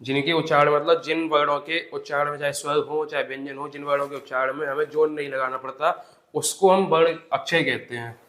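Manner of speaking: slow, spaced dictation for notes; dictating to students. Acted. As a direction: read it out word by word for writing down. Dictating definition slowly.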